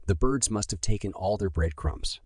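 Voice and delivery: usual speaking voice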